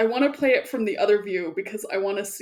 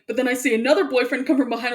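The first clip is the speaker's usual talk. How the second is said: usual speaking voice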